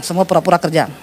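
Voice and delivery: ordinary talk, nothing marked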